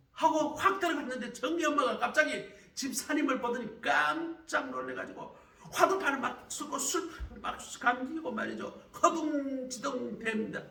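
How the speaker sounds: loud, high, driving sermon storytelling; fiery pastor belting the story to the congregation; full-force preaching. Acted. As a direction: preach it out at the top of your voice.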